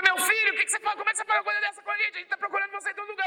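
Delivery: high, fast anxious mother; a worried mother scolding her lost son; mother's voice. Retold or imitated. Mother scolding son in retold scene. (mãe aflita, aguda e rápida)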